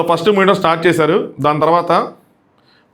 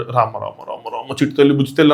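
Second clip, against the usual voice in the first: put-on soft, low coo like soothing a baby; cooing consolation; 5 semitones lower, 3 dB quieter than usual. Mocking him comforting a crying girl, soft baby cooing. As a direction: hush a crying child tenderly. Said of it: "అన్నట్టు".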